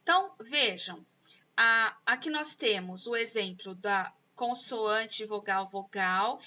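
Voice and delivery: normal talking voice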